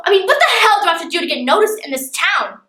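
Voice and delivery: rising, emphatic exasperation; exasperated outburst. Acted. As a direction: blurt it out, fed up with being ignored. Exasperated outburst ends the monologue.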